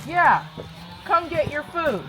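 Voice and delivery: high call across the field. Real, not acted. Calling a horse in field.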